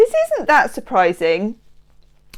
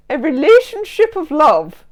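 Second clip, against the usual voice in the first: high, loud put-on voice; hammed-up mocking voice; 6 semitones higher, 6 dB louder than usual. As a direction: declaim it with mock grandeur. Hams up own odd phrase.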